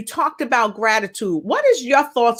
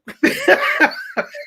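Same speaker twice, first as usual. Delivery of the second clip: high giggle; 12 semitones higher than usual. Real, not acted. Laughing at own skit.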